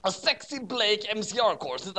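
ordinary delivery

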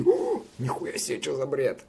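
high "ooh", then dropping back; amazed crowd. Retold or imitated. Streamer voices crowd reacting to battle on beats, mock amazement. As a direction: imitate a crowd's astonished reaction. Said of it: "и все таки".

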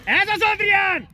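loud, drawn-out touchline cheer; real shout of encouragement. Real, not acted. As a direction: shout slow, loud praise across the pitch. Cheering a player on.

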